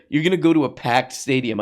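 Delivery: normal talking voice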